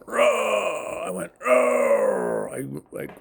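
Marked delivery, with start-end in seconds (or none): 0.0-1.0 high, drawn-out roar falling away; imitated roar. Man re-enacts his roar on getting hit, high falling roar. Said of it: "I yelled, like". 1.4-2.5 long roar with a falling tail; imitated roar. Man repeats how he roared when hit, long roar. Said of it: "I yelled, like".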